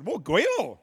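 loud, high mock-startled cry; mock astonished exclamation. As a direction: blurt it like a stunned onlooker. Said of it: "that's what".

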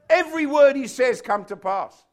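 high, loud proclamation; emphatic warning. Acted. Preacher repeating what was said of Samuel, emphatic warning. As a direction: drive it home forcefully.

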